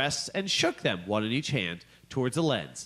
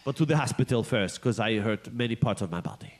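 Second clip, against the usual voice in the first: lightly hammed French voice; goofy French-accented apology; 3 semitones lower than usual. Voicing injured Frenchman.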